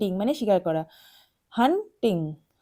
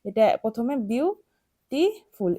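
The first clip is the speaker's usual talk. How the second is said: Beautiful said slowly, syllable by syllable; broken into syllables; much slower than usual. Shown as a demonstration. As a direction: break beautiful apart slowly. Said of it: "এভাবে ভেঙে ভেঙে পড়ছি". (Beautiful ভেঙে ভেঙে ধীরে বলা)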